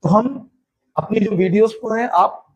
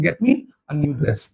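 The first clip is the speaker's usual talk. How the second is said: teacher's slow final example; slow model sentence; 6 semitones lower, much slower than usual. Shown as a demonstration. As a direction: say it slowly to close. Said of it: "आप बोल सकते हो".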